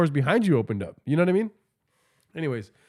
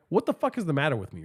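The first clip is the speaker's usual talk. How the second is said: normal talking voice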